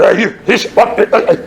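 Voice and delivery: gruff half-words and grunts; Scottish grunting approach. Retold or imitated. Storyteller confronts the scooter rider, Scottish-style gruff grunting. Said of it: "it's more grunts".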